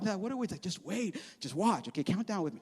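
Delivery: quiet them down before the countdown; hushed anticipation. Retold or imitated. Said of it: "And Jesus like".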